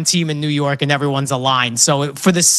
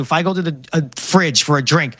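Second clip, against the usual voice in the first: ordinary talk, nothing marked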